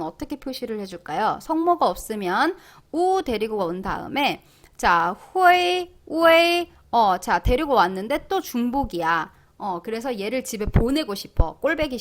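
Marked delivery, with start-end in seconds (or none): 5.3-6.7 후에이, 우에이 held on a high level tone; drawn-out 후에이, 우에이 with the e heard; pronunciation demo. Shown as a demonstration. Teacher modelling for learners, pronunciation demo.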